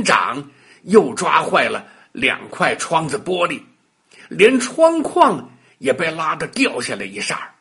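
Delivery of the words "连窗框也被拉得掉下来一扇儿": usual speaking voice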